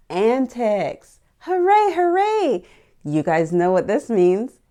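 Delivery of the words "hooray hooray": high, loud double cheer; cheering hooray. Real, not acted. Host cheering for the new Jayla book, high and loud.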